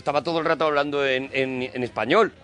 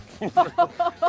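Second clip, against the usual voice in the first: high burst of laughter; laughing; 12 semitones higher than usual. Real, not acted. Laughs at sour juice joke.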